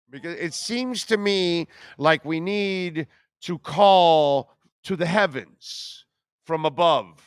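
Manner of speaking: ordinary talk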